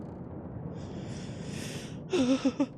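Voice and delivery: high, soft, drawn-out whimper; whimpering sigh. Acted. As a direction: sigh pitifully as the captive. Captive character despairs.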